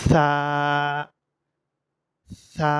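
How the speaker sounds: সা as Arabic tha, lisped and drawn out; tongue-tip th. Shown as a demonstration. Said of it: "যেমন নাকি এইভাবে উচ্চারণ করতে হবে". (জিহ্বার মাথা থেকে সা টেনে উচ্চারণ)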